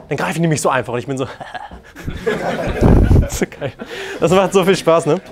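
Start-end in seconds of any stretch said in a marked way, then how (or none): 2.0-3.2 a short unvoiced laugh; quick breathless chuckle; breathy laugh. Real, not acted. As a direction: let out an airy laugh of delight. Laughs at his story.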